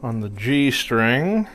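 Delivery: talking with a pick in mouth; mouth full. Real, not acted. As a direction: speak around a guitar pick held in teeth. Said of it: "I'm speaking with a pick in my mouth here".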